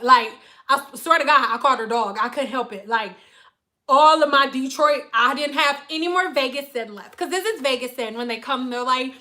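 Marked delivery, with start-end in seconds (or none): none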